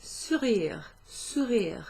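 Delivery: sourire said slowly, with a French rolled r; sourire modelled. Shown as a demonstration. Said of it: "to make someone smile".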